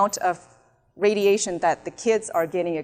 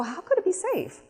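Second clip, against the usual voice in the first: high-pitched incredulous question; questionnement incrédule; 11 semitones higher than usual. Acted. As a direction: act out a baffled citizen, pitch jumping up. Voices a questioner challenging city.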